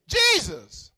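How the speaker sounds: loud, urgent cry of the name; disciples shouting. Acted. Disciples waking Jesus in the storm, shouting. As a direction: call out sharply to wake the sleeper. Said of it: "the disciples woke him up shouting".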